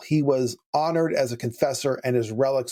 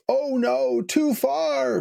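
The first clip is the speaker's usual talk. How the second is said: much higher mock woman's voice; frightened woman; 12 semitones higher, much slower than usual. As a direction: play the scared hoteler, pitched up high. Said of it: "And she says".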